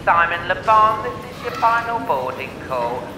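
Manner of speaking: airport boarding announcement; over a PA system. Pretend. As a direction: call it out like a tannoy. Mock airport boarding call.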